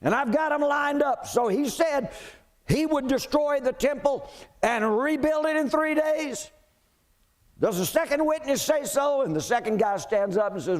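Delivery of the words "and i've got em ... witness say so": high, raised and insistent high priest, shouting on the last line; a priest eager to have his witnesses lined up. Acted. Preacher voicing the high priest at the trial, high and insistent. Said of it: "But the high priest says".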